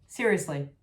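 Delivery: ordinary talk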